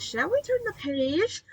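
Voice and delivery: high, drawn-up teasing question. Pretend. Reader asks if children dare go on, teasing high voice. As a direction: lift it high to tease the listeners. Teasing young listeners mid-story.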